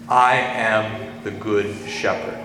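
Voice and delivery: slow, weighty stress on "I am", each title spaced out; solemn, drawn-out proclamation of "I am"; I AM in all caps. Retold or imitated. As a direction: lean hard on "I am" and take it very slowly. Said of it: "in all caps".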